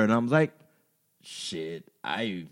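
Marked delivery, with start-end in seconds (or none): 1.2-2.5 muttered aside. Retold or imitated. Mock retort to friend.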